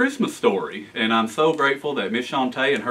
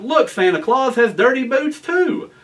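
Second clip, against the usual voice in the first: high, loud gleeful shout; triumphant kid pointing it out; excited cry; 11 semitones higher, 4 dB louder than usual. Acted. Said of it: "he jumped up and cried".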